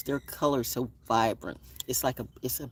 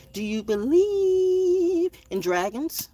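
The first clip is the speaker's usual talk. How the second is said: playful high voice; 6 dB louder, slower than usual. Acted. Playful voice asking about dragons.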